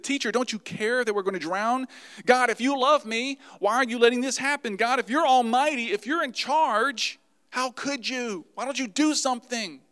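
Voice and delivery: high, loud, mounting reproach; accusing criticism. Acted. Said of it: "they criticized him".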